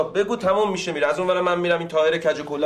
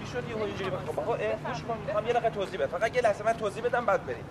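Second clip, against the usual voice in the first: hushed pleading; 4 semitones higher, 7 dB quieter, faster than usual. Acted. Hurrying to explain, pleading.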